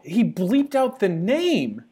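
high, slow emphatic disbelief; exasperated amazement, drawn out; incredulous. Real, not acted. Host amazed at the bleeped name.

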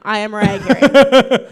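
[name] persona. Pretend. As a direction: declare it like a diva. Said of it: "that is now my character".

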